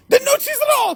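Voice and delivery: high, squealing mock shout; exasperated yell. Customer arguing with the pizza seller, exasperated. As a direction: shoot the pitch up in hammed-up frustration. Acted quarrel over cheese.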